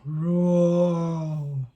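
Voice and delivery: put-on low playful roar for children; a gentle storybook tiger roar. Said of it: "hear her growl".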